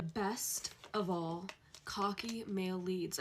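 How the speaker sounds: slow, relished delivery; savoring. Real, not acted. Fan announcing favorite trope, slow savoring. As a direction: draw it out lovingly.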